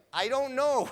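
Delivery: high, loud, sheepish shrug of a voice; dad's helpless reply. Retold or imitated. Father shrugging off his daughter's question, high and sheepish. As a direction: play the father caught out, innocently. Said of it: "he's like".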